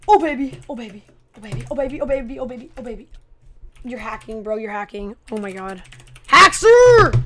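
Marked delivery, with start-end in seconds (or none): 6.3-7.2 loud, higher yell of disbelief; outraged shout. Real, not acted. Gamer accusing an opponent of hacking, shouting.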